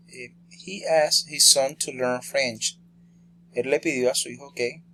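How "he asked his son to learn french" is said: very slow, careful reading of the sentence; slow dictation. Shown as a demonstration. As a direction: read it out word by word for learners. Teacher reads example sentence slowly.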